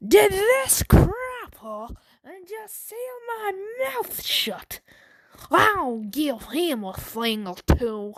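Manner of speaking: high, drawn-out fake Irish indignation; outraged Irish accent. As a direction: fume slowly in an exaggerated Irish accent. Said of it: "I'm just done doing the accents".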